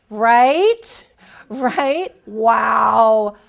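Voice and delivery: loud, disbelieving right, right, wow; incredulous. Real, not acted. Coach marvels at the candidate's blunder, loud and incredulous. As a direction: let the audience feel the blunder.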